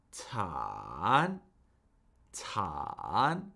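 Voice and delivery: third-tone "Tan" with a long low dip and upturn; falling-rising third tone. Shown as a demonstration. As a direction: draw "Tan" down, then swing it up. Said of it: "it falls and then it rises".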